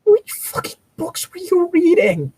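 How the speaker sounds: incredulous. Real, not acted. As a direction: shoot up in outraged disbelief. Incredulous reaction to the fic.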